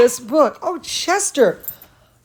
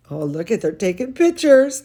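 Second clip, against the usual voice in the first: ordinary delivery, nothing marked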